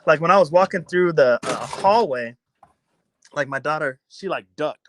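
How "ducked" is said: ordinary talk, nothing marked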